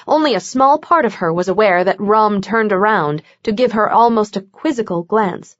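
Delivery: plain reading voice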